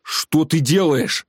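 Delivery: slow, high-pitched, drawn-out protest; outraged yell. A narrator's reading. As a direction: shout it in alarm, stretching each word. Глеб yells as water is poured.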